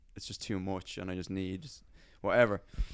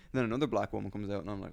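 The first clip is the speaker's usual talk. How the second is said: ordinary delivery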